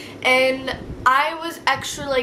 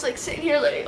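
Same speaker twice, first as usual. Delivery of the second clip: usual speaking voice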